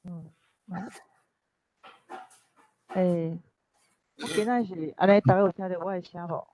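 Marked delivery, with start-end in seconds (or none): none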